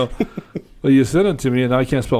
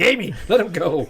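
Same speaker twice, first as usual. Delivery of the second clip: higher-pitched imitation of a scolding mother; scolding stepmom; 7 semitones higher than usual. Stepmom calls Amy off her stepson, imitated scolding. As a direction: call out as an exasperated stepmom.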